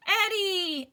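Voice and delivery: high, loud happy call; joyful cry. Acted. Al seeing Eddie return, joyful loud call. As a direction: call out in relief. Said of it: "Al cried".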